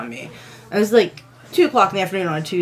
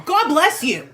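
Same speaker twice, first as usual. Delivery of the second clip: high-pitched mock holler of a blessing; mock yelling; 9 semitones higher than usual. Preacher calling back while walking off down the street, mock yelling. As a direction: imitate a zealot calling out indignantly. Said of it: "started yelling".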